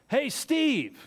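high shouted call across a stadium; a stranger hollering across the ballpark. Acted. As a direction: yell the name from far off. Joke, someone calls out in stands.

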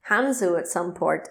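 ordinary talk

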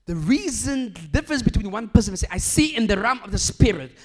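loud, high, driving sermon delivery; full-force preaching. Acted. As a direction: preach it out to the whole hall.

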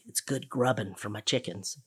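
a hint of a folksy farmer father; imitating dad. Retelling her dad feeding worms to chickens, lightly voiced dad. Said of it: "And he'd say".

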